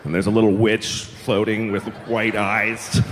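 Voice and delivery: normal talking voice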